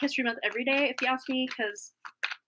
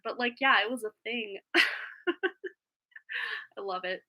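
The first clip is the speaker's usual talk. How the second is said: ordinary talk, nothing marked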